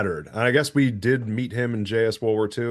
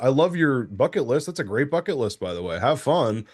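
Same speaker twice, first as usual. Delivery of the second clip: normal talking voice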